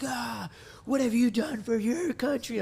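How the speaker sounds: high, slow, hammed-up indignant cry; mock drunken outrage. Young Marine making a scene at a bar refused beer, mock outraged. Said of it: "made a scene".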